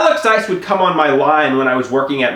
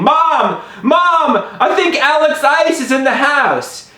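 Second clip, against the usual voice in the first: high-pitched alarmed call to mom; urgent, raised-pitch cry; frantic; 11 semitones higher, slower than usual. Retold or imitated. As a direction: wake someone in a panic. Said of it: "frantically saying".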